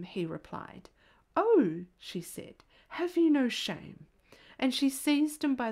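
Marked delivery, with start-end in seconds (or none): 1.4-1.9 shocked oh. A narrator's reading. Woman scolds porter's crude answer. 2.9-4.1 scolding. A narrator's reading. Woman scolds porter's crude answer.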